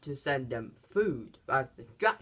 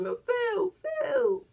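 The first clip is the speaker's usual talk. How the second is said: much higher, strained mock-cheerful voice; imitating Axel's squeaky, hammed-up hello; over-friendly greeting; slower than usual. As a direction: barge in like a fake long-lost buddy. Axel faking a friend.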